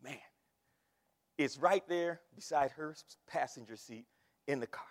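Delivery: usual speaking voice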